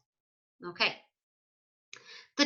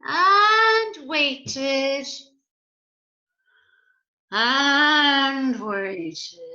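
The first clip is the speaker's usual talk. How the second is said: louder, stretched waited; drawn-out stress; 3 semitones higher, 6 dB louder, much slower than usual. A narrator's reading. Narrator stretching the long wait, louder. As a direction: lean on each waited. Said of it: "repetition stressed".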